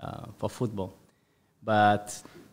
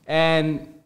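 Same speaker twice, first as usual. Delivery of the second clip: ordinary talk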